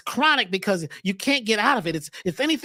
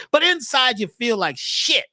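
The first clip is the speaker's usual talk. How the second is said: ordinary delivery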